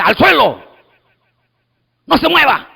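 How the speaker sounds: curt, commanding cop barking orders; stern officer's order, rising at the end; firm police command. Retold or imitated. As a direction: policeman ordering a criminal to the ground. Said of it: "El policía ahorita dice".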